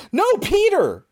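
mock panicked warning to a friend; imitated shout. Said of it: "and then she shouts".

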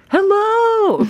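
very high, loud bubbly greeting; energetic hello. Retold or imitated. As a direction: burst of energy on the phone. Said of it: "burst of energy lady".

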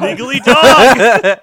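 high, loud, drawn-out mock announcement; hammed-up shout. Co-host riffing on the Legally Dog slip, hammed-up shout. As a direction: belt it out slowly through laughter.

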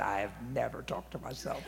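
low, soft, firm denial; deadpan denial. Retold or imitated. Preacher answering teasing coworkers, low deadpan denial. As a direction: drop right down for the comeback. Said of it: "I said".

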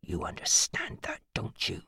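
soft whispered demand; threatening whisper. A narrator's reading. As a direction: whisper it as a warning.

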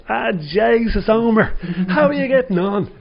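high, excited Dublin greeting; Dublin accent. Retold or imitated. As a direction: call out in a thick Dublin accent. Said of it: "forgive my Dublin accent here".

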